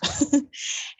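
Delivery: short breathy nervous laugh. Real, not acted. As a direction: laugh off the nerves. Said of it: "I'm nervous".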